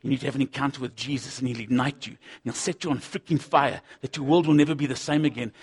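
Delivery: ordinary talk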